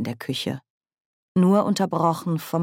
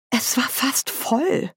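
high, slow reproach; vorwurfsvoll; 9 semitones higher, slower than usual. A narrator's reading. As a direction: complain reproachfully about the spilt drink. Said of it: "sagte Nanny vorwurfsvoll". (hoch und gedehnt vorwurfsvoll)

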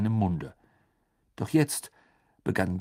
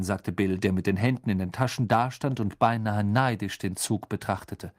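ordinary delivery

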